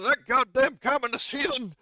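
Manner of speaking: high, loud, hammed-up fury; pissed-off outburst. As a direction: roar it in mock outrage at the betrayal. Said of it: "you're pretty pissed to hear that betrayal".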